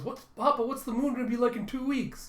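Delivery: put-on high, urgent little-kid question; high-pitched eager child voice. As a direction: pester your dad excitedly for an answer. Child urgently asking father a question.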